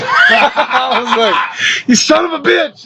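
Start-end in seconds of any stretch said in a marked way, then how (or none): 1.9-2.8 high-pitched laughing cry of outrage; mock outrage. Pranked by his friend, retold mock outrage. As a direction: exclaim it high, caught out and amused.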